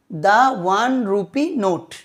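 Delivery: slow model reading, "The" pronounced "daa". Shown as a demonstration. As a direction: say "The" as "daa" before "one", slowly. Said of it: "তাহলে এখানে দা উচ্চারণ করছি". ("The"-কে "দা" উচ্চারণ, ধীরে)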